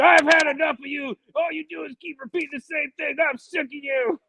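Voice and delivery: high, shouted fed-up mock voice; Roadblock quote. Roadblock fed up with Cobra Commander, shouting. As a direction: snap at him, fed up. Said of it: "Roadblock's like".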